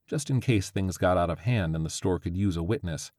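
plain reading voice

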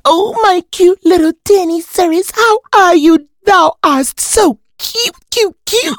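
put-on high cooing baby talk.

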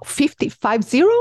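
astonished disbelieving question; surprised. Real, not acted. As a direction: ask it back in disbelief. Amazed at fifty moves.

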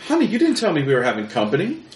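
high, sweet surprised wife; wife's voice. Acted. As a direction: lift the voice high and chirpy. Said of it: "wife voiced by GM".

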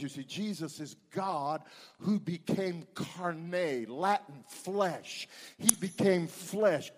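ordinary delivery

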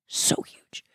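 breathy whispered awe. Shown as a demonstration. To the listener, a hushed whisper of wonder. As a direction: whisper it like a secret. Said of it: "whispered then repeated".

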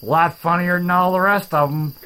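ordinary delivery

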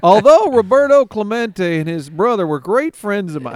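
loud, high broadcaster impression; McCarver. Broadcaster boasting of famous friends, hammed-up impression. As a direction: push it high and loud, imitating an old storyteller. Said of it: "McCarver and".

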